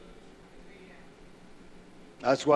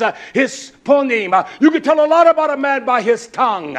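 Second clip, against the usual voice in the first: loud, high pulpit preaching with a fast push; fiery revival preacher, loud and high; preaching at full force; 8 semitones higher, 8 dB louder than usual. Acted. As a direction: thunder it from the pulpit. Preaching loudly about purity.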